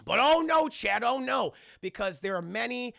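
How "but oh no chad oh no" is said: high, loud hammed-up dismay; theatrical oh-no; mock alarm. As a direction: cry out the plot twist in fake dread.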